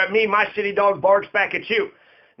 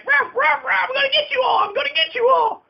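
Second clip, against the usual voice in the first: high-pitched shouted bark, mock menace; threatening barking dog. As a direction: yelp threats like a dog barking all night. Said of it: "just threatening the entire world".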